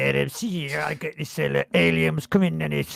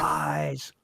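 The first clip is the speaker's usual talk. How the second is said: ordinary delivery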